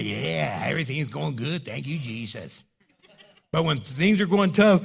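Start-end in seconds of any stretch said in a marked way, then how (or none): 0.0-2.6 casual, lower throwaway thank-you; offhand thanks. Retold or imitated. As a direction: toss it off like it's nothing. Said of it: "You just say".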